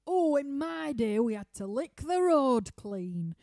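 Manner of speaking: imitating partner's hammed-up Yorkshire accent; mock Yorkshire elder. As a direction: bellow it like a grizzled Yorkshireman. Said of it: "he starts out with this".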